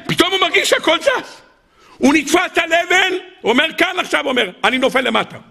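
climber in sudden fear. Acted. Climber feels rock slipping.

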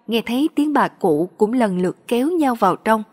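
plain reading voice

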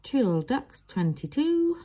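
sing-song bingo call, rising high; bingo caller. Retold or imitated. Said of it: "from the bingo lingo".

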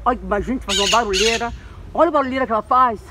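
ordinary talk, nothing marked

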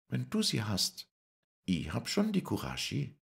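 high, bright, ringing voice; Lisei's ringing voice. Acted. Lisei answers Paul, ringing high voice. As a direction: ring out bravely and high. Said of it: "wie ein Glockenlaut schlug es".

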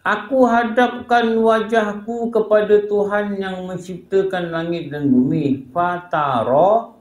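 high, drawn-out declaiming; solemn recitation. Acted. Reciting a verse to the audience, declaimed slowly.

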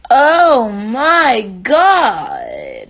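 very slow, loud, high "Oh my God"; real overjoyed shout; drawn-out excited shout. Real, not acted. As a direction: stretch it out in thrilled disbelief. Excited about a Nicki song.

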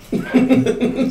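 short high laugh. Real, not acted. Host laughing mid-story, short high giggle.

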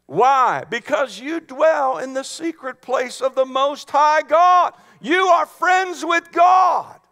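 high, loud, building to a shout; full-force preaching. Real, not acted. Preacher telling congregation they are God's friends, shouting. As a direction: proclaim it at the top of the voice.

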